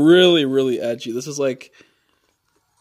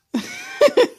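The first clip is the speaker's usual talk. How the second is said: high-pitched cackle; laugh; 5 dB louder than usual. Real, not acted. Cracking up over the prank story, high laugh. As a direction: burst into high giggles.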